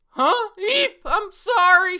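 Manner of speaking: very high, loud flustered squeak; embarrassed squeak. Acted. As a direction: yelp it out in sudden embarrassment. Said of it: "She's all embarrassed".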